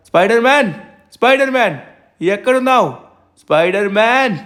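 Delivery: calling out, pitch jumping up; shouting. Acted. Visitor searching the house for Spider-Man, shouting. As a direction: holler for someone out of sight. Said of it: "ఎందుకు అలా అరుస్తున్నావు?".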